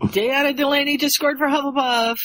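high goal announcement; acted high-pitched match commentary; excited commentator. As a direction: call the score to the stands, rising at the end.

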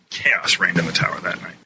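ordinary talk

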